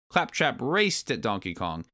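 ordinary delivery, nothing marked

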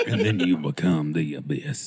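very low voice with a laugh in it; deep, mock-ominous intoning; mock philosophical voice. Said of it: "You've got the tone down".

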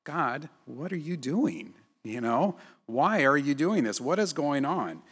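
a pleading grumble, slow at the start; complaining to God. Retold or imitated. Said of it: "I've complained and grumbled and said".

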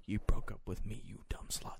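hushed, contemptuous whisper; hiss-free whispered insult under the breath; bitter whisper. Real, not acted. Retorting to his ex's excuse in a bitter whisper.

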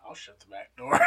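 imitating Chris, whispered and soft; breathy hushed mock line; hushed quote. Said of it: "Chris going".

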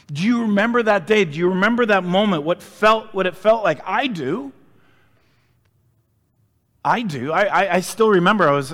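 rising, driving sermon voice; preaching at full force. Real, not acted. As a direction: preach it out to the congregation. Raised preaching passage.

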